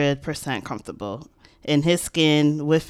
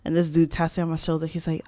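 ordinary talk

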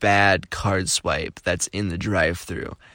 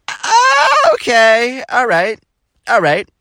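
high, loud, stretched-out "ah" into okay; flustered reaction; 13 semitones higher, 7 dB louder, slower than usual. Retold or imitated. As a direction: act out an awkward, panicked okay. Awkward reaction to being hit on.

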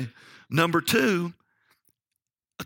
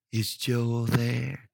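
very slow, drawn-out phone inquiry; imitated woman caller; much slower than usual. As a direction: stretch out each word, mimicking the caller. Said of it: "That's how she said".

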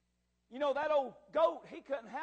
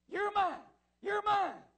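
high, raised declaration; emphatic claim; 8 semitones higher, 5 dB louder, slower than usual. Acted. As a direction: ring it out with conviction. God's claim repeated with force.

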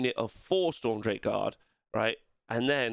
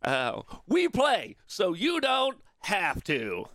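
hammed-up web show greeting, pitched up; parody channel intro; 8 semitones higher than usual. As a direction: sing out the well-known slogan, stretching the end. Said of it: "we all know how to say it".

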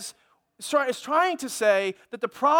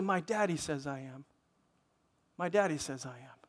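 daughter's gentle answer; 8 semitones lower, 8 dB quieter than usual. Retold or imitated.

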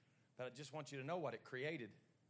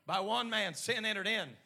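building from firm, loud preaching to a full-force shout; rising pulpit crescendo; 7 semitones higher, 20 dB louder than usual. Real, not acted. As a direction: preach it harder and louder with every phrase.

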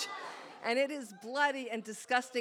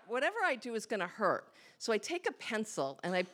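ordinary delivery

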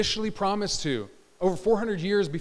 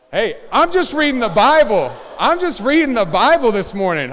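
loud, raised protest of innocence; defensive protest; 4 semitones higher, 8 dB louder than usual. Real, not acted. Preacher to laughing congregation, loud defensive protest. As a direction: throw up your hands: I'm only reading it.

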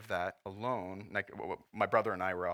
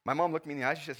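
ordinary delivery

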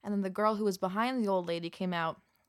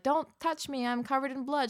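warning nurse; 6 semitones higher than usual. Retold or imitated. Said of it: "and I'm like".

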